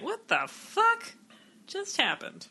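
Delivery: high, slow, stunned dog voice; bewildered talking dog. Acted. Dog suddenly able to talk, bewildered. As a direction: start high and baffled, then let it go soft. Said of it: "it'd probably be like".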